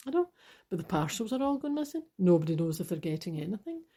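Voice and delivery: usual speaking voice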